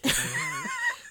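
a sudden shrieking laugh; squealing cackle; high laugh. Real, not acted. Burst of laughter.

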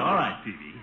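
normal talking voice

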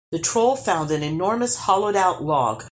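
plain reading voice

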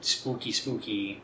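hushed, eerie spooky spooky; breathy ghost-story murmur. Acted. Spooky Halloween teaser.

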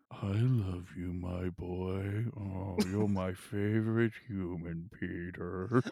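deep, slow, devoted dog; Shadow voice. Pretend. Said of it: "that's exactly how Shadow sounds".

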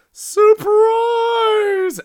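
long, high, loud drawn-out yell; stretched-out, high party holler; surprise party shout. Acted. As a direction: shout it like a whole crowd springing out. Party crowd yelling as lights come on.

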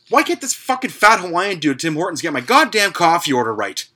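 loud, high, angry rant at a coffee shop worker; hostile rant. Real, not acted. Fuming at Tim Hortons over a coffee order, loud angry rant. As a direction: blow up at the worker. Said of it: "That was too hostile even for me".

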